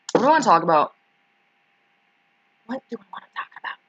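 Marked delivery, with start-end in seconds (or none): 2.7-3.8 rushed whispered repeat of the question; fast whisper. Shown as a demonstration. Streamer whispers the question to herself, quick and hushed. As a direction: whisper it quickly under your breath.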